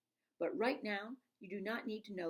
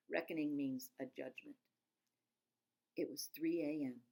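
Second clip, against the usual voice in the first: usual speaking voice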